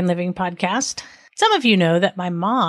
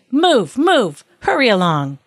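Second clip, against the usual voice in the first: louder, punched storybook commands; urgent order; 3 semitones higher, 5 dB louder, slower than usual. Acted. Man with stick herds the king along, urgent order. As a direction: drive them along with forceful repeated orders.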